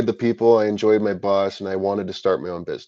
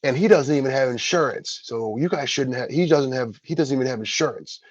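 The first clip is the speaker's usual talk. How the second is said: a higher, put-on voice for the rival blurting out; tattling competitor; 4 semitones higher than usual. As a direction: imitate the rival snitching to the store. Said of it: "he was like".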